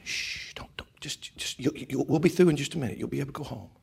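soft, whispery placating voice; devil's hushing. Acted. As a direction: hush and soothe in a halting whisper. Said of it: "the devil's probably going".

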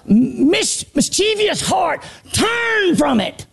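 high, shouted sermon call; preaching at full force. Acted. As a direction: preach it out to the church. Preacher shouts God's command.

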